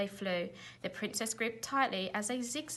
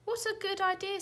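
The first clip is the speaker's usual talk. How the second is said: high, eager exclamation; Zog's happy cry; eager delight; 11 semitones higher than usual. A narrator's reading. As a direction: jump at the idea. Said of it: "said Zog".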